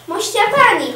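loud, high-pitched call; boisterous nobleman calling out; hearty shout. A narrator's reading. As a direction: hail the lady at full voice. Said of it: "krzyczał szlachcic".

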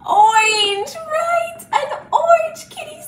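high, loud pretend wonder; amazed reveal. Teacher shows children the orange kitty, playfully amazed. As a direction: announce the colour with playful amazement.

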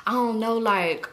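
normal talking voice

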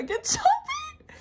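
very high, slow squeal, then a whisper; squealing the name. Real, not acted. Fan squeals the member's name to viewers, thrilled. Reveals card, overjoyed.

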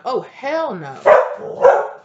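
hammed-up, slow, high-pitched indignation; acted outraged refusal. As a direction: stretch it out in mock outrage. Voices the outraged karmic's reaction.

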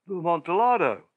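exclaimed. A narrator's reading. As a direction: burst out with "The Amontillado!". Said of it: "ejaculated my friend".

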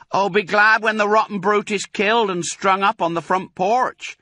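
high, loud gloating call. Acted. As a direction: holler it up the stairs. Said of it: "she called out".

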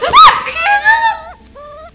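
very high, loud shriek of fright; startled scream. Real, not acted. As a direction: shriek at a jump scare. Jump scare in horror game. (chillido agudo de susto)

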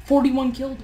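ordinary talk, nothing marked

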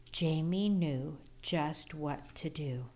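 plain reading voice

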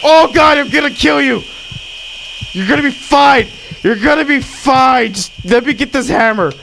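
high-pitched, frantic reassurance; panicking surgeon losing the patient; mock panic. Panicking while operating in game.

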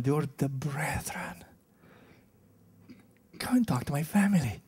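hushed whisper, quick on the second line; breathy preacher's whisper. Acted. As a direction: lean in and whisper it like a secret. Preacher whispers Jesus's words.

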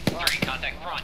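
loud urgent callout; combat callout. Acted. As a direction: shout the contact. Calling contact.

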